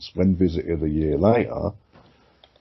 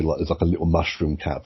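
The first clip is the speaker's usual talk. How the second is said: ordinary talk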